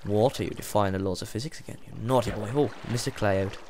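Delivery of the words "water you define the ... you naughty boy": ordinary delivery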